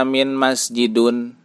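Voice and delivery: "min masjidun" closing on -un; wrong -un ending. Shown as a demonstration. Teacher showing the misreading, "min masjidun" ending in -un. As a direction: end "min masjidun" with u and n instead of -in. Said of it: "Tidak dibaca".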